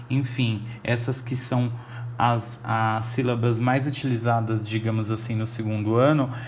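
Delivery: ordinary delivery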